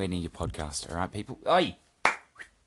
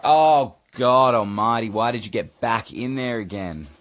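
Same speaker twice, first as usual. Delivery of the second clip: exasperated outburst; 3 semitones higher, 8 dB louder, slower than usual. Real, not acted. Cat gets back in again.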